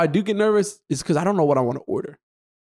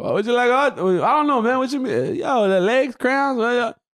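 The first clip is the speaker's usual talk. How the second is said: higher mock East Coast accent; put-on accent voice; 6 semitones higher than usual. Shown as a demonstration. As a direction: switch into a high East Coast voice. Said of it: "I can go from that to".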